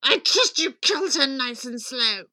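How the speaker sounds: gleeful shriek falling to a gloat; delighted villain queen; crying out. A narrator's reading. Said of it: "The Queen cried out".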